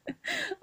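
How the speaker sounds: short high giggle at herself; embarrassed laugh. Real, not acted. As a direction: laugh off the mispronunciation. Laughs at her own bad accent. (petit rire gêné et aigu)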